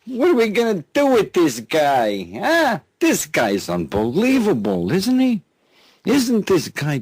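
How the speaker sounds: high-pitched, exasperated amusement; mocking disbelief. Real, not acted. Radio host asking listeners about this guy, incredulous and high-pitched. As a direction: throw your voice up high in scornful disbelief.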